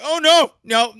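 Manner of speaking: put-on high, pleading little-kid voice; whiny begging kid. Kid pleading with the teacher, high whiny begging. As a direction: beg like a kid scared of losing presents.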